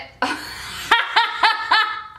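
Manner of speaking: high-pitched burst of laughter. Real, not acted. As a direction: crack up in high, repeated bursts. Cracks up while reading her notes.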